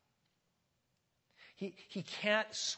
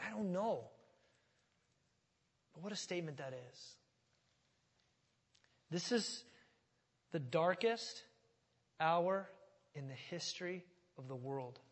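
usual speaking voice

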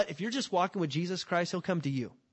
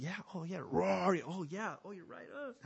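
much softer, meek caving in; cowed giving in; 2 semitones higher, 7 dB quieter than usual. Acted. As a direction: fold meekly, voice shrinking to almost nothing. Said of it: "we start going".

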